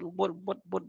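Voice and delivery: ordinary delivery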